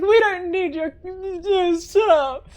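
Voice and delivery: high, loud tearful snap, slow at the end; snippy crying nurse. Acted. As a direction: bite back through fake tears. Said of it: "the crying nurse just looks at you".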